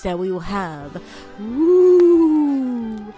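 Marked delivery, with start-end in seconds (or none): none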